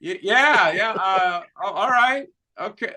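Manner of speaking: halting, unsure imitation of going along; hesitant mock agreement, tailing off; awkwardly baffled. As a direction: stumble politely through a confused agreement. Acts out stranger's baffled, awkward reply.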